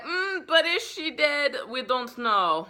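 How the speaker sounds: high, slow, strange mock-wise voice for Van Helsing; odd put-on voice. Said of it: "I don't know why I'm talking like that".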